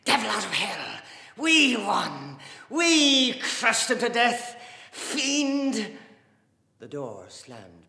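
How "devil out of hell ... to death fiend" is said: Dante. A narrator's reading. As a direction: shout quivering with rage, loud and high. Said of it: "shouted down the room".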